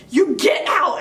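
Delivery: shrill, high shouted command; screaming at a ghost. Retold or imitated. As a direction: yell at the ghost to leave. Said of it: "she starts screaming at it".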